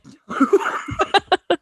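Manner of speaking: high bursting giggle of refusal; embarrassed laugh. Real, not acted. Friend asks for an accent example, she laughs it off embarrassed. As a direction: laugh off the request, a little flustered.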